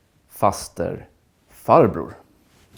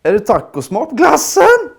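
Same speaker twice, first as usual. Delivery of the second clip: while gargling; 13 semitones higher, 6 dB louder than usual. Shown as a demonstration. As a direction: voice cracking high and loud, gargled. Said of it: "make that gargling sound in your throat, like this".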